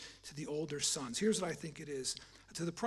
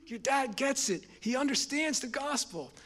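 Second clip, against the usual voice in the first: high, loud, excited call; a friend bursting with happy news on the phone; 8 semitones higher, 5 dB louder, slower than usual. Retold or imitated. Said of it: "he's all excited".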